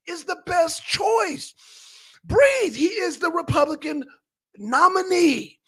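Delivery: high, insistent chant of breathe; preaching breathe. Real, not acted. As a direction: keep hammering breathe like a sermon. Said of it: "preaching breathe to viewers".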